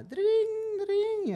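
imitando el "dring" de un teléfono que suena, el segundo muy agudo; timbre de teléfono. Retold or imitated. Imita la llamada telefónica. (imita el timbre del teléfono que lo llama)

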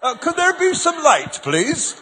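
high, hammed-up, prim request; mock polite God. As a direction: play God as a comically courteous asker. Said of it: "He didn't say".

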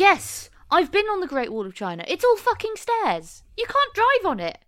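high, incredulous comic rant; exasperated outburst. Real, not acted. Biggest beef with film scene.

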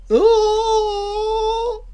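impressed gasp at the screen; real surprised ooh. Real, not acted. Reacts to a scene on screen.

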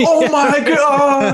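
very high, slow mock gasp; mock amazement. Friends picture their stunned reaction, slow high gasp. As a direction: stretch out the shocked reaction. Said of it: "and we're all like".

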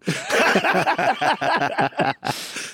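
high burst of laughter at his own punchline; real laugh after the Toyota joke; big laugh. Real, not acted.

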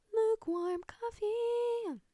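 high, slow announcer billing. Pretend. Vlogger introducing her coffee as a guest star, announcer voice. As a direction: announce it like a star's entrance.

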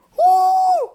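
high, loud woo; excited cheer. Real, not acted. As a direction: shout it out, thrilled. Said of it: "I'm so excited".

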